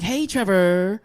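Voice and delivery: lightly affected greeting; a hint of camp in the hello; campy tone. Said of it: "if they're talking in that tone".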